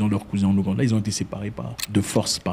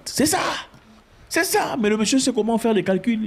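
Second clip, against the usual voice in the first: exclaiming; 11 semitones higher than usual. Real, not acted. Exclaiming in exasperated agreement.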